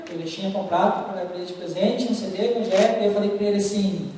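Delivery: ordinary talk, nothing marked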